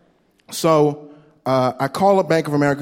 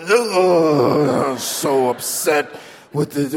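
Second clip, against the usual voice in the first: hammed-up wail about work; mock crying coworker; much slower than usual. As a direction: fake sob, slow and drawn out. Coworker mocked as crying upset.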